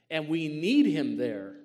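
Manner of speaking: slow, loud preaching boom. Real, not acted. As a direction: boom it out from the pulpit. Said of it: "Sorry, I didn't mean to boom that out".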